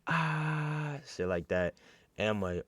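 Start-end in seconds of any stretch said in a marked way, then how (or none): 0.1-1.0 high, sustained mock scream; long held high "ah" as imitation; imitated screaming. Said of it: "they, like, screaming".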